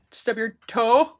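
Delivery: slow, drawn-out teasing stub your toe; mock sympathy. Real, not acted. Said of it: "repeats the question".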